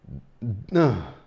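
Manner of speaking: long exhaled sigh; heavy sigh. Real, not acted. Vlogger wrapping up the rant, heavy sigh. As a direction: let out a weary sigh. Vlogger exhausted after ranting.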